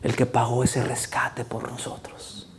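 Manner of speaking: whispered, slow awe; reverent whisper. Acted. Preacher whispering the mystery to the congregation. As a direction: breathe it out.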